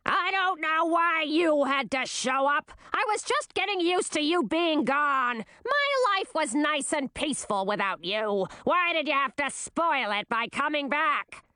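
high, shrill-edged nagging queen voice; screeching queen. A narrator's reading. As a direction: screech it out like a bitter old queen. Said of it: "Olavine's unmistakable screech".

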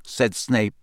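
plain reading voice

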